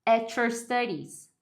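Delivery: "At your studies" as "a-chor studies", slowly; slow model of "At your studies" with a ch in "At your"; T plus Y becomes CH. Shown as a demonstration. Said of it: "viraram um som de ch".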